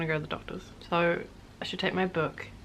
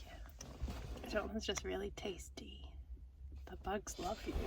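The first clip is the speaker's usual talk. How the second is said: soft hushed whisper; 14 dB quieter than usual. Real, not acted. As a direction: keep it to a breathy whisper. Whispering beside sleeping Jonathan.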